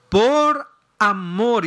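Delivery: por amor, drawn out very slowly; slow emphatic. Real, not acted. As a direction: stretch out por amor, savouring each word. Repeated slowly.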